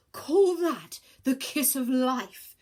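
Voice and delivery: slow, loud, wild scream. Acted. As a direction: scream it in desperation, dragging it out. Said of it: "she screamed wildly".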